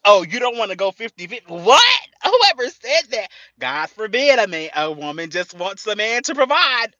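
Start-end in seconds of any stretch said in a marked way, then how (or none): 1.5-3.3 high, loud, disbelieving what; outraged what. Real, not acted. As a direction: act offended at the idea. Outraged at critics.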